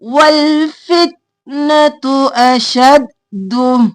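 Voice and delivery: slow, drawn-out tajweed chant; Quran recitation. Shown as a demonstration. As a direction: recite it slowly, stretching the vowels. Reciting verse for students.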